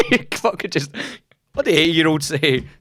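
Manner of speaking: high, loud, laughing disbelief. Real, not acted. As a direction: push the words through a laugh. Laughing at the friend's video.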